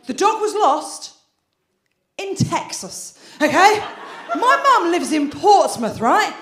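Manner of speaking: comic outrage, slowing down; incredulous. Real, not acted. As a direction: spell out how absurd it is. Incredulous building setup.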